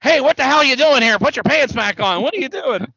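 high, loud mock scolding; hammed-up indignant yelling; Mean Gene. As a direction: imitate an exasperated interviewer telling someone off. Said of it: "and Mean Gene's great next".

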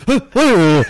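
high, loud imitated yelp; hammed-up startled hooting. Imitating friend jumping from a slap, startled yelp. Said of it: "And Jonah jumped. He goes".

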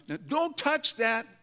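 mock-alarmed parental warning; drawn-out warning. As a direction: stretch it out to stop a wandering hand. Acting Jesus warning the wanderer.

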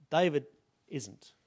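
ordinary talk, nothing marked

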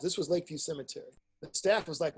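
ordinary delivery

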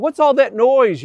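high, loud puzzled call. Retold or imitated. Townspeople hearing the crowd call out, loud and puzzled. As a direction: shout it like a startled townsman.

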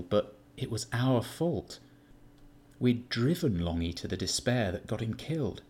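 ordinary delivery

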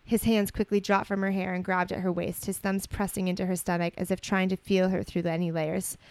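measured storybook reading voice; story narration. A narrator's reading. Co-host reading romantic fan fiction aloud, story narration. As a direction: read it out evenly as narrative.

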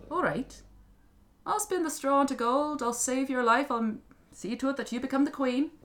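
high, loud leprechaun voice; little creature striking a deal; bargaining little man. Acted. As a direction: grant it grandly as a little imp.

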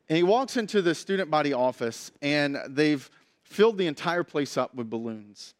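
ordinary talk

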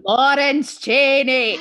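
loud, slow "Lawrence Chaney" with a long drawn-out fall; [name] impression. Host announcing the queen's name on the show, loud and slow. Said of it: "[name] is obsessed with trying to say".

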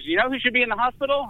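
fast, high mock nurse; lightly voiced scolding nurse; quizzing nurse.

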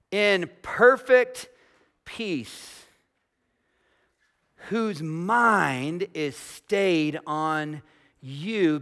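very slow, deliberate verse reading; solemn, stretched-out proclamation with long pauses; scripture reading. Acted. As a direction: draw out each phrase of the verse, starting high and strong and settling down. Reads a Bible verse aloud.

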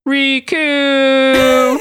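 high, drawn-out cry of a name; loud, stretched falsetto holler; Sora's distant call. A narrator's reading. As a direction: call "Riku" far off, long and high. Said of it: "you could hear Sora say".

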